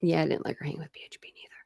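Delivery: fast whispered aside. Real, not acted. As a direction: rush it out under your breath. Whispered aside while reading chat.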